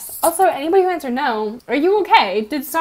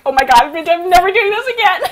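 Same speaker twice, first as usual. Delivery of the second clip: acted outburst climbing high and louder; retold freak-out; 6 semitones higher, 5 dB louder than usual. Vlogger retelling her scarring moment to viewers, mock freak-out. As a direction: play up the horror, pitch rising into a laugh.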